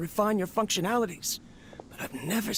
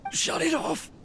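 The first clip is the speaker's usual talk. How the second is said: desperate cry; 12 semitones higher than usual. Acted. Urgent order while dying.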